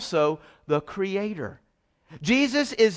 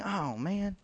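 mock disappointed aside; 8 semitones lower, 12 dB quieter, much faster than usual. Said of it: "I know you're thinking".

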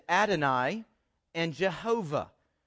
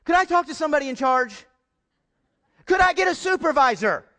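raised, exasperated plea for a supervisor; frustrated caller; 7 semitones higher, 8 dB louder, faster than usual. Retold or imitated. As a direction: imitate a fed-up customer on the phone.

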